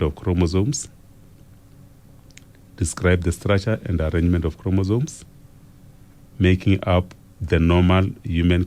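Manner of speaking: measured dictation with long pauses between phrases; slow dictation. Real, not acted. Teacher reading an exam question for students to write down, slow dictation. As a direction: read slowly for note-taking, phrase by phrase. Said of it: "I'm going to read it slowly".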